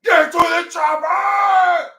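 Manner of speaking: high, slow, loud bad Austrian shout; bad Austrian Schwarzenegger. Streamer doing a bad Austrian Schwarzenegger, slow high shout. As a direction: bellow it in a bad Austrian accent. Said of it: "My Austrian is not good".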